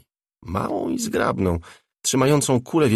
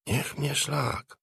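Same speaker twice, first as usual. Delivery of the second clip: muttered curse; 6 semitones higher, 7 dB quieter, much slower than usual. A narrator's reading. Ślizg mutters in awe at the man by the fireplace. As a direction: mutter it slowly, barely aloud. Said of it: "mruknął".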